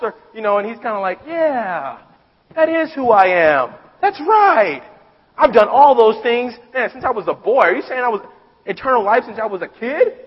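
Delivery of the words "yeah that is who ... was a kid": higher, louder, self-satisfied bragging; smug excitement. Retold or imitated. Rich young ruler boasting to Jesus, smug excitement. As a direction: crow about being the good one.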